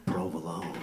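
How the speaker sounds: low, soft smooth-radio PROVOLONE; sexy provolone. Acted. DJ ending a love dedication, sultry "Provolone". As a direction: say "Provolone" low and softly, like a Light FM DJ. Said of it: "it's, like, sexy".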